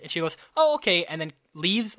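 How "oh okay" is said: high, loud mock acceptance; hammed-up easy agreement; breezy acceptance. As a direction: pitch up an imitated "oh, okay". Imagined customer easily accepting.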